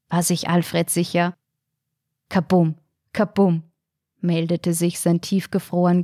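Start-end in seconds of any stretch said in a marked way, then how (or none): 2.3-3.6 heartbeat sound. A narrator's reading. Heart pounding with love.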